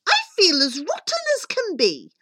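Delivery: high-pitched wail of complaint; cried complaint. Acted. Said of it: "cried Cindy".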